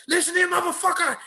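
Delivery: very high, quick yell; mock angry outburst. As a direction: imitate someone suddenly exploding, laughing after. Acts out her sudden blow-up.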